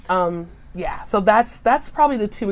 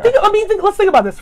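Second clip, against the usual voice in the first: excited; 12 semitones higher, 6 dB louder, faster than usual. Real, not acted. Excited pitching of the posting.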